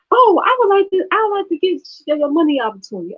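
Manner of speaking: high, sugary fake interest; fake eager. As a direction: play the gullible mark to bait the scammer. She fakes interest to troll.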